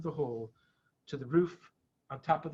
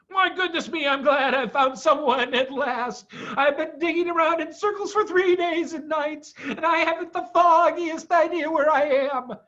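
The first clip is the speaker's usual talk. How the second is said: a high, fussy, worn-out animal voice; lost and relieved at once; fretful Badger; 11 semitones higher, 5 dB louder than usual. A narrator's reading. Badger after three lost days, fretful relief.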